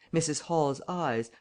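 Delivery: plain reading voice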